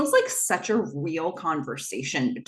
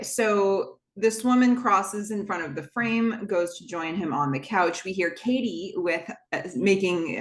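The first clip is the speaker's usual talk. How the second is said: ordinary delivery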